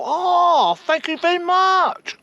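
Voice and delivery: high, slow mock Chinese restaurant accent. Chinese restaurant worker answering a customer's complaint, hammed-up Chinese accent. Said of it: "I forgot the accent".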